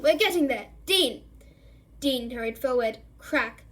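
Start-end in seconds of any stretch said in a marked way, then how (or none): 0.0-1.2 loud urging call. A narrator's reading. Lupin urges class on.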